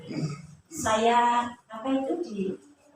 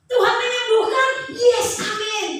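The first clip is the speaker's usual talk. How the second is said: much higher and louder, hammed-up enthusiasm; mock cheerful answer; 10 semitones higher, 4 dB louder than usual. Preacher mocks believers' easy answers, hammed-up cheer. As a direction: mimic the glib churchgoer's easy faith. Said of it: "Gitu jawabannya".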